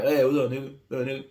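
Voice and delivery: relaxed, easy greeting between friends; laid-back friendly hello. Retold or imitated. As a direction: greet a buddy casually. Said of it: "the term of endearment".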